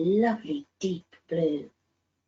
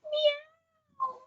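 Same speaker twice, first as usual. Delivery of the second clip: a long, high, thin meow; high scared meow. Acted. Storyteller voicing Rosie tossed in the storm, a high frightened meow. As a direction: wail like a frightened kitten.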